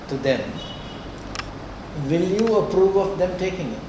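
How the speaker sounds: ordinary talk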